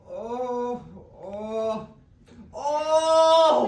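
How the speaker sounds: loud high wailing oh; high mournful keening. Shown as a demonstration. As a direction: wail it out, rising. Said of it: "It's sort of like this".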